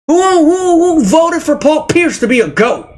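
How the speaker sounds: who voted for him, incredulous; outraged disbelief. Real, not acted. Outraged at the vote.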